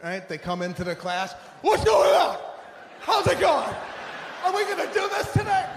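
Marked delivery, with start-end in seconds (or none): 1.6-5.7 high, loud, hyped-up chirpy voice with a laugh; fake-positive gym bro. Gym regular hyping up the class, loud fake cheer.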